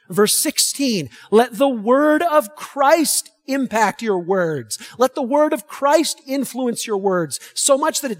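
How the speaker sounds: loud, high-pitched, emphatic verse reading; forceful scripture preaching. Real, not acted. Preacher reading Colossians to the congregation, loud and forceful. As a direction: proclaim the verses with full force.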